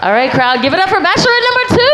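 high, fast game show hype; booming pumped announcer. Acted. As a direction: pump up the crowd like a host. Host hyping the crowd.

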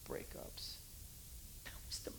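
breathy whispered aside. Real, not acted. Preacher murmurs about breakups, whispering. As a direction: drop to a hushed whisper.

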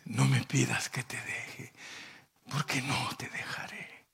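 soft, breathless whisper of devotion; whispered vow. Retold or imitated. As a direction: hush it like a sacred promise. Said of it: "¿Y qué le dice Ruth".

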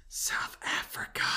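slow, whispered fake answer; mock whisper. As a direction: whisper it slowly, as a prank. Whispered suggested wrong answer.

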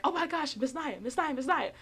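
put-on high, eager kid calling; excited girls. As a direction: squeal her name like gossip can't wait. Excited girls call her name.

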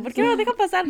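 mild mock yell, pitched high; retold shout. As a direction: act out a yelled complaint at normal volume. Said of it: "gritaba así como".

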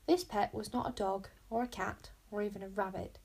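usual speaking voice

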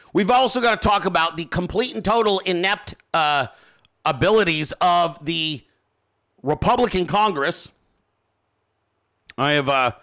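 ordinary talk

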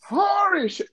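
very high squeal; squealed exclamation. Acted. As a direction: shriek it playfully. Echoing Holy shit.

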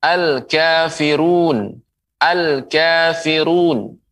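slow, careful "al-kafirun"; slow model reading. Shown as a demonstration. Teacher modelling "al-kafirun" slowly to viewers. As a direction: sound out "al-kafirun" for students. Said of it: "Contoh yang lain".

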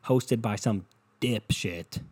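slow, stretched-out "dipshit"; drawn out. Acted. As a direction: drag the words out lazily. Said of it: "Why'd you extend the word?".

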